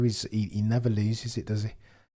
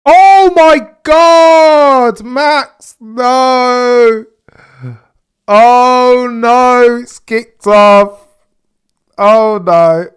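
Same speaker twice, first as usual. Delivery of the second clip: loud, high, drawn-out cry of disbelief; shocked shout; 12 semitones higher, 17 dB louder, much slower than usual. Real, not acted. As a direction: yell it out in horror at the crash. Shocked by Max crash.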